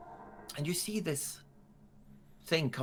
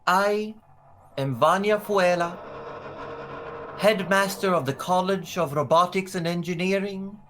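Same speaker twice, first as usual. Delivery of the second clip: raised, measured ceremonial announcement; stately public proclamation; regal headmaster; 7 semitones higher than usual. Acted. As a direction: present it proudly to a crowd, pausing between phrases. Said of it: "this regal-looking woman".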